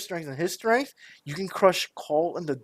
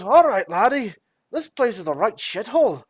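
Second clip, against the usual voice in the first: higher, louder grumbling dwarf voice; brash dwarf; 6 semitones higher, 4 dB louder than usual. Acted. Dwarf repairman greets his employer, brashly. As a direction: greet them as a blustery dwarf handyman.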